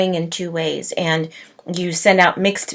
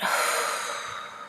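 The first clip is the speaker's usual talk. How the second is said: long, soft sigh; slow releasing out-breath; letting it out; 13 dB quieter than usual. Shown as a demonstration. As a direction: breathe it all out in one long exhale. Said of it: "Let it all out".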